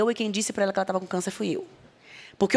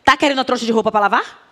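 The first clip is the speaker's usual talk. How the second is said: scolding aunts; 4 semitones higher, 6 dB louder, faster than usual. Retold or imitated. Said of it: "As minhas tias falariam".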